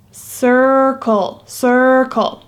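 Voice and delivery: circle said slowly as SER-kul with ER vowel; ER sound in circle. Shown as a demonstration. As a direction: stretch the ER in circle. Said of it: "listen to when I say it".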